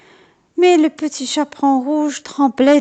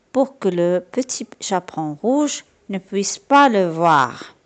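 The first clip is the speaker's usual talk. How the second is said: ordinary delivery, nothing marked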